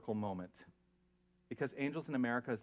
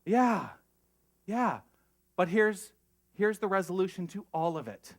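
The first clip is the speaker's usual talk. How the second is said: higher and louder, rallying the room; fired-up preaching; 7 semitones higher, 8 dB louder than usual. Real, not acted. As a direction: lift them up with a loud burst.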